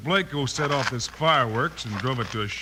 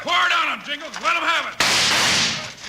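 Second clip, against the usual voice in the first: high, loud urging yell; battle shout; 14 semitones higher, 4 dB louder than usual. Acted. Bill urging Jingles to keep firing, loud yell. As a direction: egg Jingles on over the gunfire.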